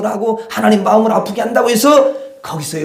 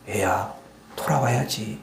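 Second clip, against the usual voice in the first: very low, much softer gentle plea; a waiting father calls his child back, tender and hushed; 10 semitones lower, 12 dB quieter than usual. Retold or imitated. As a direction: coax the lost child home softly. God tenderly calls child home.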